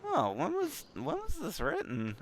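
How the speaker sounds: high, incredulous squeak; mock surprise. Reader puzzled by dated book tech, high mock disbelief. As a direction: play up the baffled reader. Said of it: "I was like".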